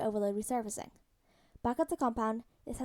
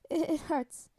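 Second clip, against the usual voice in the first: mild, higher-pitched whimper; a touch of pained whimper; 4 semitones higher, slower than usual. A narrator's reading. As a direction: stammer it out as a hurting kid. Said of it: "Peter whimpered".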